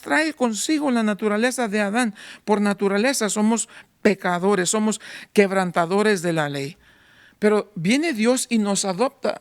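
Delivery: ordinary talk, nothing marked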